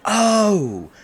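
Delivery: sudden high, loud gasp of realisation; surprised oh. Acted. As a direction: it suddenly clicks for her. Sudden realisation mid-date.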